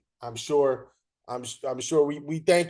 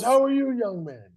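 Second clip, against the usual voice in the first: put-on higher, louder old-timer greeting; lightly voiced veteran umpire, friendly; Doug Harvey; 8 semitones higher, 4 dB louder than usual. Retelling his phone call, voicing Hall of Famer Doug Harvey, higher and friendly. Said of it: "And he goes".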